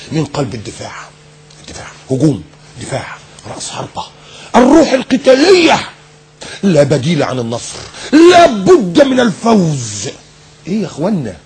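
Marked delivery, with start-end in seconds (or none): 4.6-5.8 high, drawn-out martial slogan; mock bombast. Mocking war-like football vocabulary. 8.1-10.1 high, very slow emphatic slogan; mock bombast. Mocking pre-match slogans.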